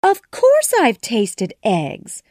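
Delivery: loud, slow insistence; firmly honest; earnest. A narrator's reading. Truthful Alice insisting to the pigeon, loud and slow. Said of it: "said Alice".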